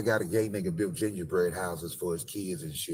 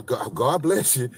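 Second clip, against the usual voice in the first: higher, louder, slow God bless you; mock churchy blessing; 5 semitones higher, 8 dB louder, slower than usual. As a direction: bless him as you push away. Women blessing him.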